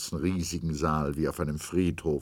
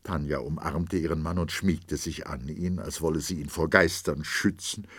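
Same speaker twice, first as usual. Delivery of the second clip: ordinary delivery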